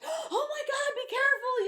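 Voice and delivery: high-pitched, loud retold panic; freaking out. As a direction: gasp it out in hammed-up alarm. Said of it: "like, all freaking out".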